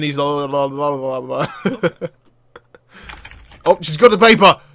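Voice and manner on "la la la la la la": low, soft la-la babble; quiet, low run of meaningless syllables; imitated mumbling. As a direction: imitate someone mumbling nonsense under the breath. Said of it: "he's doing some mumbling".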